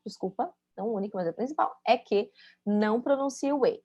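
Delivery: ordinary delivery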